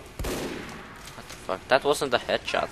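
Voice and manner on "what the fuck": slow breathy disbelieving whisper; stunned whisper. Real, not acted. Gamer whispers in disbelief at a failed headshot.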